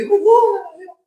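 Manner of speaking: hammed-up whimpering sobs, high-pitched; mock sobbing. Preacher mocks a sinner begging God for pardon, hammed-up sobbing. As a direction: fake a tearful whine to God. Mocks the religious person's tearful pleading.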